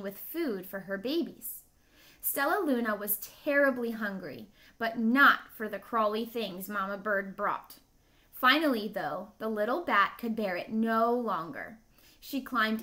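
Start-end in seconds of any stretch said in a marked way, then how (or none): none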